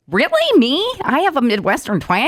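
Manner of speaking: high-pitched, disbelieving retort; mock incredulity. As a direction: replay being taken aback by the criticism. Retelling her indignant reply to critic.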